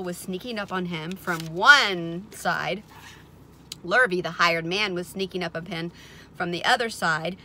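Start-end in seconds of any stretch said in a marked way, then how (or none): none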